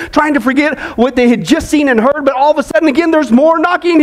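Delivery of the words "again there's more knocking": usual speaking voice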